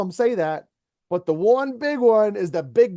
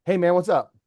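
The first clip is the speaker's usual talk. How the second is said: normal talking voice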